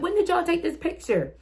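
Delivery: high-pitched incredulous question; mock disbelief. Asking family about secret pictures, high-pitched disbelief. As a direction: voice climbs in playful protest.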